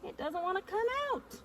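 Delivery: high strained voice while pulling; straining. Real, not acted. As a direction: squeeze it out with effort. Straining to pull frame out.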